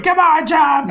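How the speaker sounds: high, strained mock holler; imitated crowd urging. Impatient people below calling the diver on, high mock yell. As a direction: call up to a hesitant diver in a high pitch. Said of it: "all these people going".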